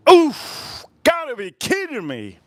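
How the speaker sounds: high, loud oof, then a sharp exclamation; shocked cry, then an incredulous remark; disbelieving oof. Real, not acted. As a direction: yelp of disbelief before the words. Disbelief at the review.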